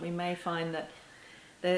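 normal talking voice

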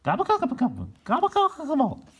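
very high gobbling calls; turkey gobbles. Acted. Turkeys gobbling in celebration.

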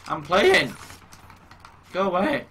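high, loud, irritated call; annoyed shout. Real, not acted. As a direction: shout them away, irritated. Calling back to an interruption.

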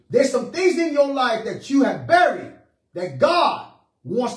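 loud, high-pitched exhortation; impassioned preacher declaring a promise; full-force preaching. Real, not acted. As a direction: hammer it home to the congregation.

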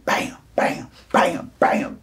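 voiceless percussive blows; imitated punch noises. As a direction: spit out a burst of mimicked punches.

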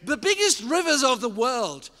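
emphatic preaching. Real, not acted. Preacher describing the Old Testament river, emphatic preaching. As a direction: proclaim each river with weight.

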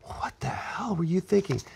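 hissed scolding whisper; whispered what-the-hell; mock scolding whisper. Cruise ship scolding retold.

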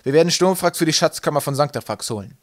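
commanding announcement. A narrator's reading. Captain revealing the mission to his crew, proclaiming. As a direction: proclaim the mission to all hands.

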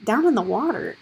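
usual speaking voice

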